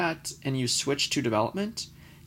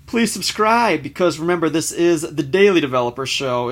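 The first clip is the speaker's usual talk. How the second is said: enthusiastic; 3 semitones higher, 8 dB louder than usual. Real, not acted. Outro, pushing subscribe.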